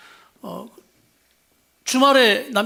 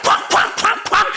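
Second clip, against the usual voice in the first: booming bangs; 12 semitones higher, 6 dB louder, slower than usual. Retold or imitated. Imitating God's thunder.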